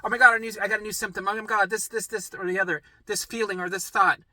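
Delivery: high-pitched mock alarm; hammed-up anxious urgency; imitated panicky complainer. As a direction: report symptoms like a flustered worrier. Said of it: "when people say".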